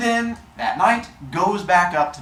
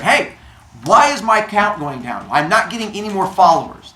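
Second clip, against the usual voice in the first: mock yell; 4 semitones higher, 6 dB louder than usual. Zeus yelling at the humans.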